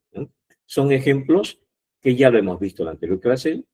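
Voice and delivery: normal talking voice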